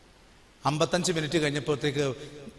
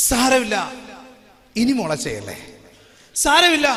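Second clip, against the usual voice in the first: gentle forgiveness, voiced loud; 5 semitones higher, 8 dB louder than usual. Voicing God's gentle words.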